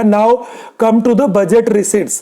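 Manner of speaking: ordinary talk, nothing marked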